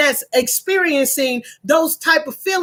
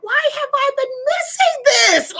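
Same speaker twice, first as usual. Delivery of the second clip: high voice, bursting with regret and joy; excited exclamation; 11 semitones higher than usual. Retold or imitated. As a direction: exclaim it, amazed at herself. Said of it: "I'm like".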